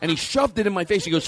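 ordinary talk, nothing marked

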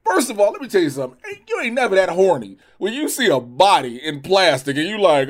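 ordinary delivery

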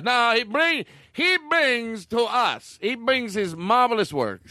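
high, hammed-up Australian voice; insistent fake Australian, pitched well up; fake Australian accent. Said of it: "Is that an Australian accent".

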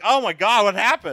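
high, loud shocked outburst; shocked gasp. Retold or imitated. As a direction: react like you just saw something alarming. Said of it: "you'd be like".

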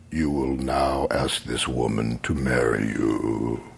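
deep, slow, booming voice of fate; deep otherworldly command from within; commanding inner voice. Retold or imitated. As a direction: intone it low and slow like a prophecy. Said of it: "then I hear, through this energy".

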